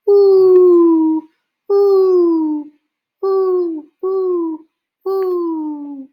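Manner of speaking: very high, loud distant owl reply; answering owl hoot. A narrator's reading. Owl answering from the trees, high loud hoot. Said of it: "an echo came threading its way".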